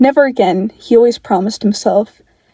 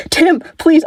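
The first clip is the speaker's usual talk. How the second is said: higher, desperate begging; frantic plea; 7 semitones higher than usual. Acted. Dick begging Tim over the phone, frantic higher plea. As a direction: reach for him, pitched up and pleading.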